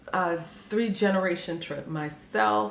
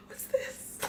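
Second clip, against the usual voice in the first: breathy, softer imitation of a stunned mom; astonished gasp; 6 dB quieter than usual. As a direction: retell it as a hushed gasp of surprise. Mother opens surprise gift, gasps.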